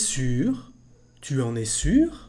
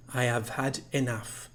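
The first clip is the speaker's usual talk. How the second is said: ordinary talk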